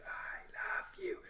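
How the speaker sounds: breathless unvoiced whisper, slow. Becky whispering her confession into Tom's curls, unvoiced whisper.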